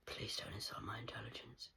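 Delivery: cold, soft whisper. Real, not acted. Warning the viewers in a whisper. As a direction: breathe it out low and menacing. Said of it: "whispered to camera".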